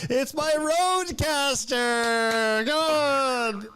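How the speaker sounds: high hammed-up showman announcing; goofy high emcee voice; silly clown. Announces stand-up set as clown.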